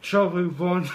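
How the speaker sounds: laughing through a stuffed mouth; cheeks stuffed full. Real, not acted. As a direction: giggle out the words round marshmallows. Chubby Bunny challenge, laughing, mouth full.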